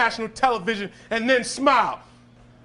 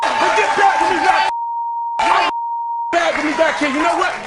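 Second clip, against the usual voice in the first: furious scolding; 4 semitones higher than usual. Real, not acted. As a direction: lay down the law, full force. Scolding defiant teen on show.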